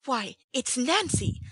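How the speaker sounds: slow, high cry of recognition; astonished. A narrator's reading. Oliver sees Nancy's face, slow astonished cry. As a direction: gasp it out in disbelief. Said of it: "irrepressible astonishment".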